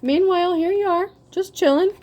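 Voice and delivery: lightly voiced carefree chill; carefree sing-song. Mocking carefree target.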